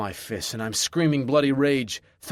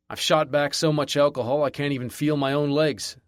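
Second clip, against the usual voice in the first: usual speaking voice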